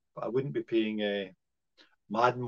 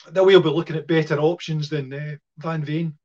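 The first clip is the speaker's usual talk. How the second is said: choking; 3 semitones higher, 9 dB louder than usual. Real, not acted. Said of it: "sorry, I'm choking here".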